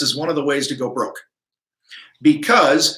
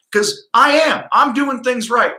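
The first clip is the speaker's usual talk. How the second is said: pitched up high, proud and emphatic; fired-up boast; 8 semitones higher than usual. Real, not acted. Contractor ranting to viewers about competitors, raised fired-up voice. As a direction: hammer home that you do it right.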